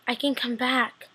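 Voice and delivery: slow, soft, half-whispered plea; ghostly plea. Acted. Vicky asks to come back, slow hushed plea. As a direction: plead faintly, fading into a whisper.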